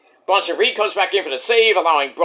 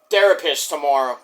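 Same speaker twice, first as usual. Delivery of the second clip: ordinary talk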